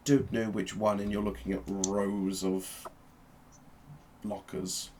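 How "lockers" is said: usual speaking voice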